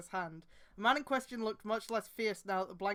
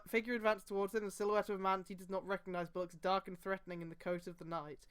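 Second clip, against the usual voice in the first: ordinary talk, nothing marked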